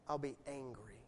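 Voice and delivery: sudden hushed, low warning; quietly angry God. Acted. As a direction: drop low and soft, gravely. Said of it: "notice the opposite emotion".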